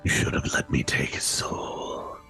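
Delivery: low hissing whisper; pixie. Acted. Inner voice whispers.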